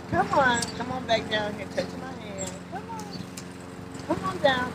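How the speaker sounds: high, soft, eager calls; excited coaxing. Real, not acted. Urging the tree like a puppy, eager high coaxing. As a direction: coax like calling a puppy.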